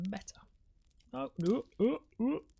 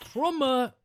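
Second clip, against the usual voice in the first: higher, badly faked German accent; hammed-up German, landing near Finnish; attempted German accent; 5 semitones higher, 5 dB louder, slower than usual. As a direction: have a clumsy go at a German accent. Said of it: "I was trying to do German".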